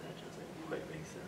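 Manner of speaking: slow breathy whisper; puzzled whisper. Real, not acted. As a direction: mutter it softly to yourself. Puzzled aside after device answer.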